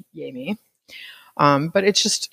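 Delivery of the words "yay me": wry whisper under the breath; whispered aside. Real, not acted. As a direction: drop to a sarcastic whisper. Sarcastic whispered aside about herself.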